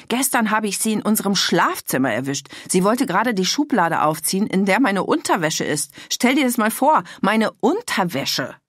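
loud, high indignation; outraged. A narrator's reading. Mother telling her daughter about the underwear drawer, outraged and loud. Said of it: "Stimme überschlug sich fast vor Empörung".

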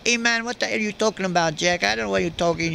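quick, hammed-up gang kid talking back; street tough. Gang members retort to Welles.

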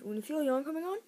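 ordinary delivery

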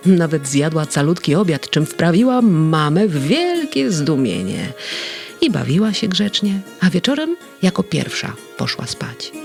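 ordinary delivery

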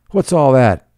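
loud demand. A narrator's reading. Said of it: "he demanded".

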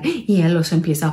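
plain reading voice

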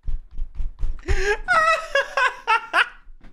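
high burst of real laughter; shrieking laugh of disbelief. Real, not acted. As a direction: crack up hard at the screen. Reacting to anime wallpaper.

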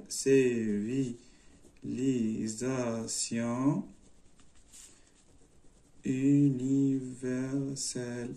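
CIVILISATION UNIVERSELLE very slow, low and soft; drawn out. Shown as a demonstration. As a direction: stretch CIVILISATION UNIVERSELLE syllable by syllable. Says answer while writing it.

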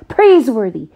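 loud, sudden excited shout; exclaiming. A narrator's reading. Jack suddenly shouts to the butler, excited. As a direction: burst out with the idea. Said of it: "he exclaimed".

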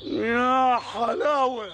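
drawn-out delight. Acted. Wife finally asks him back.

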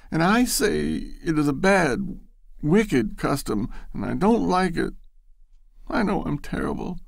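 high, firm defiance; defiant. A narrator's reading. Loretta defies Bashford, high and firm. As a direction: stand her ground. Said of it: "she added defiantly".

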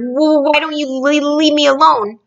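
high, stuttering plea; anxious, pleading stammer; nervous stammer. A narrator's reading. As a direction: stutter it out, frightened. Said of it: "he stammered".

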